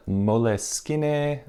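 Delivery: Moleskine as "mo-leh-skee-neh", four slow syllables; every e sounded in Moleskine, drawn out; alternative pronunciation. Shown as a demonstration. Listing viewers' suggestions, Moleskine said "mo-leh-skee-neh". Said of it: "other recommendations for how to pronounce this".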